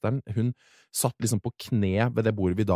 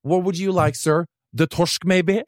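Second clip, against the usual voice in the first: higher, polite waiter voice; 6 semitones higher, 4 dB louder, slower than usual. Retold or imitated. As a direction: mimic the waiter offering the fish. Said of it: "Han sa bare sånn". (høflig kelner, lys stemme)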